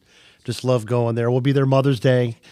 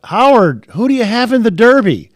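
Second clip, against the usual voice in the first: loud, much higher call across the paddock; yelling out; 11 semitones higher, 12 dB louder than usual. Retold or imitated. Fan calling out to Howard Cosell in the paddock, loud yell. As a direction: call out to get his attention. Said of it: "I yelled out".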